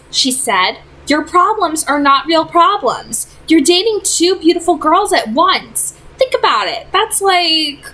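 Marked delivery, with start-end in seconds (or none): 1.1-7.8 put-on lightly raised young woman's voice; a teen girl pleading with a friend; gently higher voice of an exasperated girl; Clary's voice. A narrator's reading. Clary teasing Simon.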